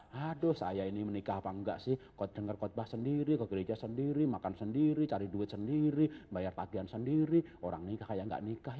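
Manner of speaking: low, soft self-pitying grumble; suffering lament. Acted. Churchgoing wife lamenting that she does everything alone, subdued suffering. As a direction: list your grievances in a subdued, put-upon voice. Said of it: "lalu merasa menderita".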